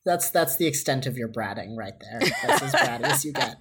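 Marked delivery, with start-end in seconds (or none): 2.5-3.6 high giggling through the words; laughing. Real, not acted. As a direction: laugh it out while teasing. Teasing, laughing.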